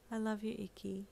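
soft, tender declaration; loving. A narrator's reading. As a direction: say it quietly, with a smile. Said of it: "smiling with love and compassion".

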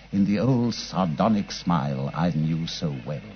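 reflective storyteller's narration; story narration. A narrator's reading. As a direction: recount it like a memoir read aloud. Narrates the story looking back.

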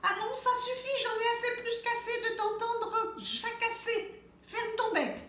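exasperated mother hen yelling; mother hen shouting. A narrator's reading. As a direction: yell it at the chatterbox chick. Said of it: "Mère Poule se met à crier".

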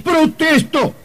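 high-pitched flustered protest; indignant protest. Acted. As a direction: squeal the objection in disbelief. Man protests he wasn't murdered.

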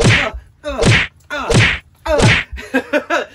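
mock panting grunts. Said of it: "run and be like".

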